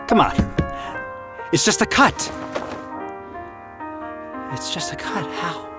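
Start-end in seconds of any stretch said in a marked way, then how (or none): 4.5-5.7 shaken disbelief. Acted. Shaken disbelief after a knife wound.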